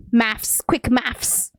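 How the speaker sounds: maths said as "mafs" with an f; British maths. Shown as a demonstration. Streamer demos the British maths for Lily. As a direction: finish maths on an f sound. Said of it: "th, not a th".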